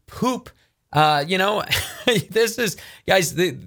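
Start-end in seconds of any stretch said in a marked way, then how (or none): 1.7-2.2 brief breathy chuckle; short incredulous laugh. Real, not acted. Speaker finds it all ridiculous, short incredulous laugh. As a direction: let out a quick disbelieving laugh.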